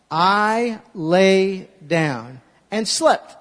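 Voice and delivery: slow, drawn-out verse; leading the verse slowly; slow recitation. Shown as a demonstration. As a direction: spell it out word by word. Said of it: "Let's say it together".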